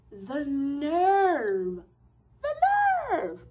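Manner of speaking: high, loud, near-crying "the nerve"; outraged disbelief. Real, not acted. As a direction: let the outrage crack through. Outraged at his call.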